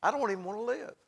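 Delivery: soft, weary voicing of a man who quits; Elijah crying out. Elijah in the valley giving up, softly despairing. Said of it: "he's crying out".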